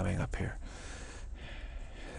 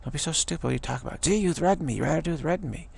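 exasperated, higher-pitched outburst; mock frustration; 7 semitones higher than usual. Imitating someone fed up with the accusers, mock frustrated. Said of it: "you get so frustrated with it".

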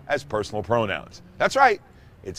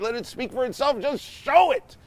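emphatic; 11 semitones higher than usual. Real, not acted. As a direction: push it, insist hard. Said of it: "You can just show it".

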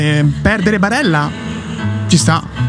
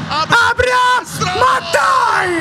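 frustrated shouting; 10 semitones higher, much slower than usual. Real, not acted. Missed chance during live match.

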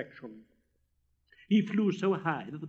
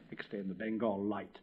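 plain reading voice

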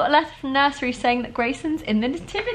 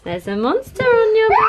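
excited; 12 semitones higher, 4 dB louder than usual. Real, not acted. Mum teasing child, laughing shout.

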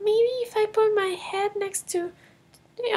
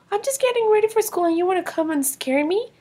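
normal talking voice

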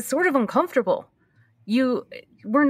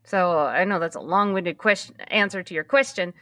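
usual speaking voice

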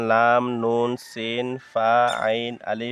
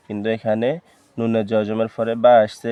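ordinary talk

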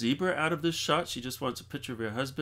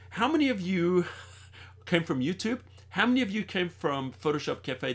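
laughing; 6 semitones higher, 4 dB louder than usual. Real, not acted. Asks the audience again, laughing.

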